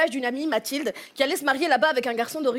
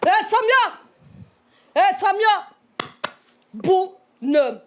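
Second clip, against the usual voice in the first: excited Algerian fiancé; 5 semitones higher than usual. Acted. Algerian fiancé welcomes friend at the border, boisterous excitement. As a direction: hail a friend with brash, overflowing enthusiasm.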